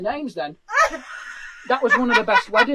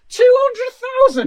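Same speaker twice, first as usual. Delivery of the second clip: big loud reveal, higher and slow; excited shout; 10 semitones higher, 9 dB louder, slower than usual. Real, not acted. Telling Gemma the viewer count, loud excited reveal. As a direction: hit the number hard with disbelief.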